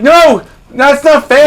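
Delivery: high, loud, drawn-out objection; mock-aggrieved, high and loud; whiny protest. Acted protest at unfair chant.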